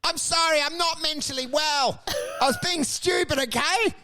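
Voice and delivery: high, whining apology from the ground; hammed-up pitiful excuses; acted pleading. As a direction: beg off an attacker. Beaten man begs on the ground.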